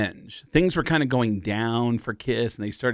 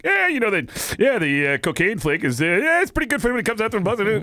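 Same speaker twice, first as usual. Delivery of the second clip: higher, rushing know-it-all voice; sped-up Cliff Clavin; 10 semitones higher than usual. Retold or imitated. High-on-coke teen speeding through Cliff impression, fast and high. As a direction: Cliff rattling on faster and faster. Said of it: "it just started speeding up".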